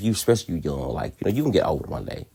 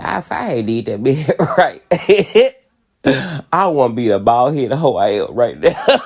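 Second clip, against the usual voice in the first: laughing through the words at herself; 5 semitones higher, 6 dB louder than usual. Real, not acted. Laughing at herself while confessing to viewers. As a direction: giggle out the confession, louder as it goes. Laughing at her own past.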